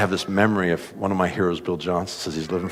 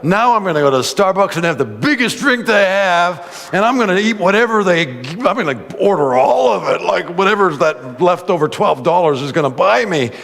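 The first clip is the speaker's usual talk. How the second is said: high, loud giddy excitement; preacher recalling giddy plans, high and loud; 9 semitones higher, 6 dB louder than usual. Retold or imitated. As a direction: bubble over with glee about Starbucks.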